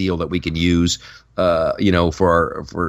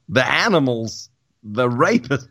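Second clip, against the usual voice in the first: Christopher Walken as Trump; 4 semitones higher than usual. Retold or imitated. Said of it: "I can do Christopher Walken".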